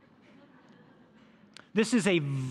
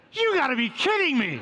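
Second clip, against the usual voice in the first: higher and louder, with a laugh in it; incredulous yell; 8 semitones higher, 4 dB louder than usual. Retold or imitated. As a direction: yell it in disbelief. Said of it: "And Peter says".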